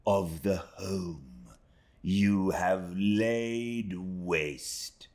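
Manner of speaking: slow, deliberate, cold accusation. Acted. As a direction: press each question home slowly. Rosa accuses Emily.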